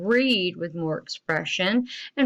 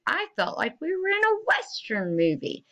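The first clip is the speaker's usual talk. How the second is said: higher-pitched, excited finish; excited exclamation; 10 semitones higher than usual. Shown as a demonstration. Teacher showing children the story's last sentence, read with excitement. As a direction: end it like an exclamation. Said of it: "I ended that same way".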